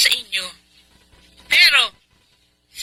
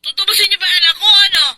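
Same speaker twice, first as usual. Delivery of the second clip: fast, pressing taunt that gets louder; pushy taunting; 6 semitones higher, 4 dB louder than usual. Acted. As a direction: goad them, then bark the last word. Kidnapper demands ransom, pressing them. (mabilis at nanunuyang pamimilit)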